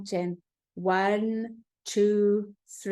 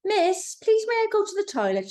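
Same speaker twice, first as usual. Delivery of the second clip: put-on much higher, small girl's polite request; a child asking her teacher to leave class; little girl asking; 12 semitones higher, 3 dB louder than usual. A narrator's reading. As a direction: ask sweetly as a young schoolgirl. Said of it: "she asks".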